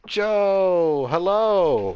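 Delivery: loud, high, drawn-out call; excited greeting. Real, not acted. Streamer welcoming Joe to the horror fix, loud excited call. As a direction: sing out the greeting, big and high. Calls out greeting to arriving viewer.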